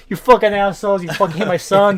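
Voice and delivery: a loud mock yell through the wall; retold yelling. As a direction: yell it like an angry parent through a thin wall. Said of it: "start yelling through the walls".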